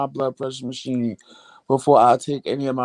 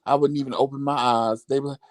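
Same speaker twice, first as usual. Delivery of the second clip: ordinary delivery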